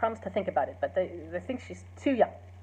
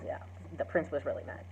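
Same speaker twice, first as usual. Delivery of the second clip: hushed reply; 5 semitones lower, 9 dB quieter, faster than usual. Retold or imitated. Benvolio answers Romeo.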